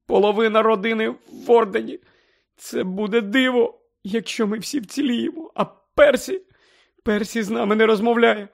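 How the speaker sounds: high, tearful pleading; crying. Acted. Mother crying to Harry about her family, high. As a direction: pour out fear through sobs.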